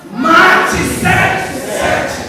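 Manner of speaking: slow, high, loud drawn-out shout; very strong. Shown as a demonstration. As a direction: roar it slowly, every word stretched. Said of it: "Vamos falar bem forte".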